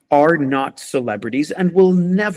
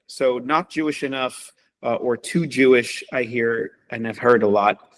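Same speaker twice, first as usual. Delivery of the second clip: ordinary talk, nothing marked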